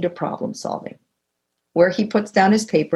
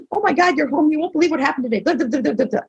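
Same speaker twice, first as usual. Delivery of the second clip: higher, gushing, imitating her younger self; eager gush; 5 semitones higher, much faster than usual. Wife rushing up to her husband home from work, excitedly gushing. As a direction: burst out with news, starved for company.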